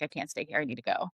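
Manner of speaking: low, soft and resigned; subdued retold plea. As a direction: drop low and quiet, retelling a need to escape. Retells her breakdown, first take.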